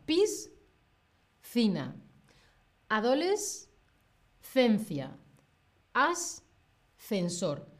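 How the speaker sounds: "Piscina, adolescencia, ascensor" very slow, pausing between sounds; slow with pauses. Shown as a demonstration. Teacher models pausing inside hard words, very slow. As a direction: separate the s and th in each word, taking your time. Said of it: "Haz una pequeña pausa".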